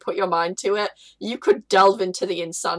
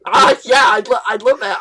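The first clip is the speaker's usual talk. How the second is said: excited shout; 7 dB louder than usual. Real, not acted. Excited response to idea.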